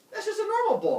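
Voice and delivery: loud, high exasperated mock protest; comic indignant objection; arguing. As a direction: squabble with voice way up. Acted argument over the bull.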